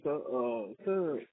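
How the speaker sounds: usual speaking voice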